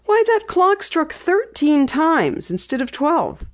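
put-on light girl voice for Penny; Penny, lightly voiced. A narrator's reading. Penny telling her dad she'll drive, lightly voiced girl.

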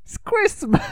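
very high, squeaky mock kid voice; New York kid. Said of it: "the kid says".

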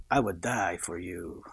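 low, soft breathy whisper; romantic accent. Retold or imitated. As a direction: whisper it like a lovestruck suitor. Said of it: "you gotta talk in a real good accent".

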